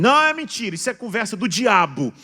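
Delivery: loud, high-pitched indignant outburst; mock outraged denial. Parent told the son is acting strange, mock outraged denial. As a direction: burst out in exaggerated denial. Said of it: "Já viu gente falar assim?".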